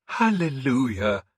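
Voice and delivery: the priest questioning a pagan lord; wary priest. A narrator's reading. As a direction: probe him gently as the suspicious priest.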